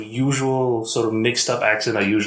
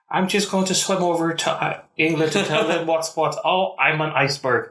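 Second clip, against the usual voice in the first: mock Scottish swimmer; 5 semitones higher than usual. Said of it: "it's really fucking cold".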